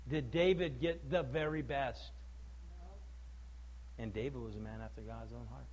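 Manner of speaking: quiet earnest warning from the pulpit; hushed, low preaching. Acted. Pastor warning the church quietly about sin's cost, hushed and low. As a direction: drop it low and soft, pleading.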